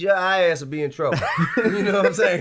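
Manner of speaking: loud laugh on the punchline. Real, not acted.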